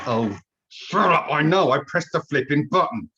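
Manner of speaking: hammed-up, fed-up grumble; irritated retort. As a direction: snap back at an annoying automated voice. Acted irritated retort to Zoom's voice.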